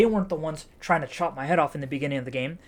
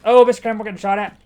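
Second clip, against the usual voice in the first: loud, higher startled cry at being shot at; startled shout; 6 semitones higher, 12 dB louder, much faster than usual. Real, not acted. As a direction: jolt up loud and high. Startled under gunfire in game.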